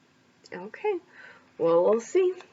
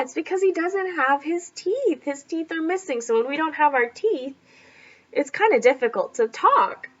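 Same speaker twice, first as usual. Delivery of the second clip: ordinary delivery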